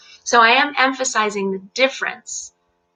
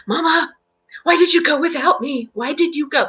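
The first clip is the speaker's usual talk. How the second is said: high-pitched pleading voice for a dog; hammed-up hurt pup asking its mama; pet dog's whine; 7 semitones higher than usual. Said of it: "She would just sit there and go".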